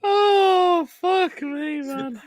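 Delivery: high, drawn-out laughed-out exclamation; recovering from laughter. Real, not acted. Host recovering from friend's joke, high and laughed out. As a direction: sigh it out high after a real laughing fit.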